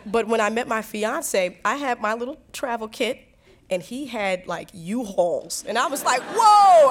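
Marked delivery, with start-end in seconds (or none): 6.2-6.9 loud, high cry of alarm; shocked whoa. Retold or imitated. Woman recalls seeing fiance's baggage, loud astonishment. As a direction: let out a big startled whoa.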